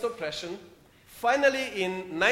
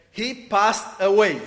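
careful best English; 5 dB louder than usual. Retold or imitated. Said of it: "with his best English".

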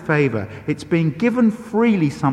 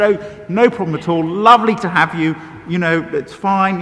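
higher, louder polite reassurance, acted out; effusive mock politeness; gracious hostess; 5 semitones higher, 5 dB louder than usual. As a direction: imitate an over-polite hostess brushing off disaster. Hostess politely excuses the damage.

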